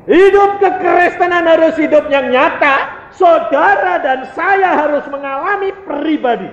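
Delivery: high, loud exhortation; forceful preaching. Real, not acted. Preacher urging listeners to experience faith personally, high and fervent.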